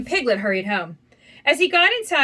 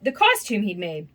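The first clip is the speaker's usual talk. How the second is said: plain reading voice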